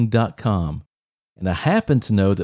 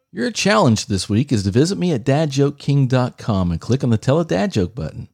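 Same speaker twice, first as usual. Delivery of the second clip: ordinary talk, nothing marked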